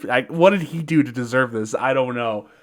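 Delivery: ordinary delivery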